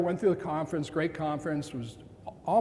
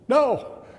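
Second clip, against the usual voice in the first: 1 blurted no; 8 semitones higher, 8 dB louder than usual. Retold or imitated. Blurting distress to stranger.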